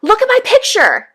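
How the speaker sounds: put-on high, loud excited child calling Mom; a kid begging Mom to look at a drawing, high and loud. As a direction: pipe up like an eager little kid. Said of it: "my kids will be like".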